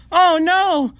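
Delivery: high, loud wail of dismay; dismayed cry. Acted. Character sees his ruined face, shrieks in dismay.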